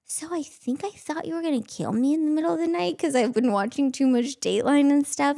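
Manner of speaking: sheepish confession. Retold or imitated. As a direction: own up with a giggle.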